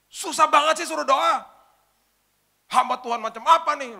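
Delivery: high, loud, indignant snapping at the pastor; angry outburst. Retold or imitated. Young woman snaps at the pastor for stalling prayer, angry. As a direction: scold him like he's useless. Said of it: "kali ini dia marah".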